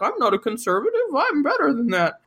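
lightly mocking, indignant retort; mock wounded protest. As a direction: imitate someone taking it to heart, pitch rising at the end. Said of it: "he takes this to heart".

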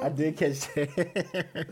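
high, very slow, laughing; words stretched through laughter. Real, not acted. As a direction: still cracking up as he says it. Laughing at the clown promo.

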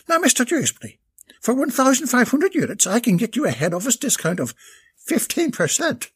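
put-on bright, eager young saleswoman pitch; sweet-talking Irish sales lass; high saleswoman voice. Maeve closes the deal.

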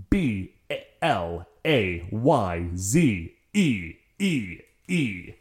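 slow, letter-by-letter dictation with gaps; measured, separated letters; spelling it out. Shown as a demonstration. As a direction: spell it deliberately, one letter at a time. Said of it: "It was spelled".

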